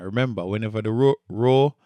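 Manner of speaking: normal talking voice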